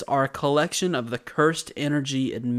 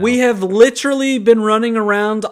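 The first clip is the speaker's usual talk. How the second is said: exasperated exclaim; 7 semitones higher, 8 dB louder than usual. Real, not acted. Exasperated at the plot.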